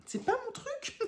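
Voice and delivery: high, softening into a laugh; laughing admission. Real, not acted. Embroiderer confesses the yearly project isn't her thing, laughing. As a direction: let a giggle overtake the words. Admits failing, bursts into laughter.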